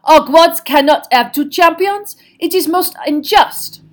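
high, loud, haughty protest; indignant, voice lifted well up; outraged French headmistress. Acted. French headmistress protesting that it is unfair, outraged.

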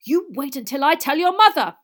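high, loud warning; angry threat. A narrator's reading. Father threatens sons with their mother, angry threat. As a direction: threaten them at full volume.

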